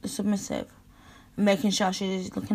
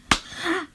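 sharp gasp; 7 semitones higher than usual. Acted. Shy reaction to being undressed.

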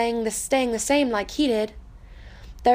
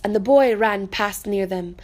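ordinary delivery

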